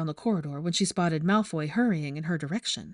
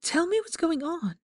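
high, pressing demand; 10 semitones higher than usual. Acted. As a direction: she insists he tell her. Hermione demanding answers.

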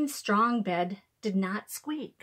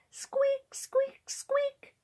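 very high mouse squeaks; squeaky high-pitched mouse voice; chirping mouse; much slower than usual. A narrator's reading. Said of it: "chirped the mouse".

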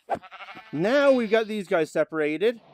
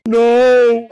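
loud, higher cry of NO; anguished shout; 6 semitones higher, 15 dB louder than usual. Real, not acted. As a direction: cry out no in dismay. Killed the wrong animal.

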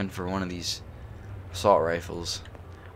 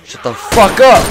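loud, much higher furious yell; furious shout; 10 semitones higher, 14 dB louder than usual. Real, not acted. Player yells at the noisy horde, furious shout. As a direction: scream at them to shut up.

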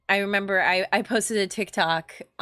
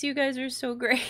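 ordinary talk

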